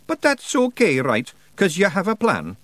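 high, loud Scots pixie voice; brash wee Scottish fighter; Feegle voice. Acted. Rob Anybody brushing off Roland's doubts, high and brash.

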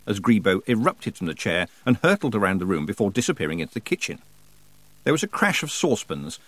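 plain reading voice

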